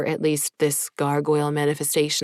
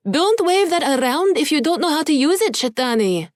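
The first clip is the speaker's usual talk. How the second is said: high, loud call across the yard; 11 semitones higher, 5 dB louder than usual. Acted. Woman walking away, calling back to the demon to stop waving the sword, loud and high. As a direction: call out a sharp warning from a distance. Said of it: "Esmeralda called".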